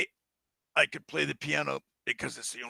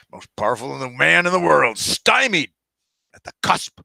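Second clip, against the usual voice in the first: frustrated rant; 2 semitones higher, 9 dB louder than usual. Acted. President ranting about his fate.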